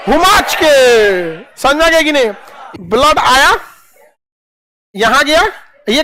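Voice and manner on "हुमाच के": slow, heaving हुमाच के with a laugh; forcefully. Shown as a demonstration. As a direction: shove हुमाच के out with effort. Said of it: "ये कैसे ढकेलेगा".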